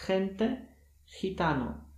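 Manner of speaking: "gente, gitano" with a breathy H-like g; teacher's example words; Spanish g demo. Shown as a demonstration. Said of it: "wymawia się jak H".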